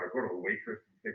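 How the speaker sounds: usual speaking voice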